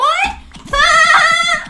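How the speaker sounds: high, drawn-out giggle; gleeful laugh. Pretend. Player showing off a diamond sword, high gleeful laugh. Laughing over new weapon.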